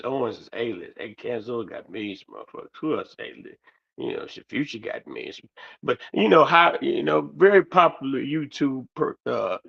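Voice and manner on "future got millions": normal talking voice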